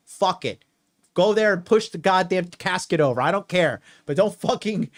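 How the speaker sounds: usual speaking voice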